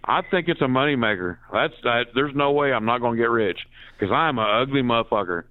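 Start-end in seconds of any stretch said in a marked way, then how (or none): none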